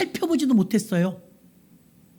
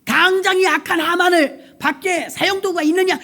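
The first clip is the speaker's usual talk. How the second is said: furious king calling for the gallows; angry king shout; 6 semitones higher than usual. Acted. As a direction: bark out the order of execution. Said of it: "불호령이 떨어졌어요".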